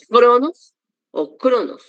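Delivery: "cronos" first with jota, then with k; two accepted pronunciations. Shown as a demonstration. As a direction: slowly offer "cronos" as jronos, then kronos. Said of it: "esta ji a veces se pronuncia como".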